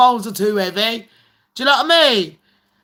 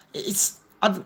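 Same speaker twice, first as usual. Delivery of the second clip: usual speaking voice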